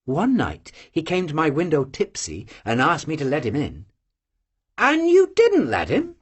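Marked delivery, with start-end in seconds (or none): none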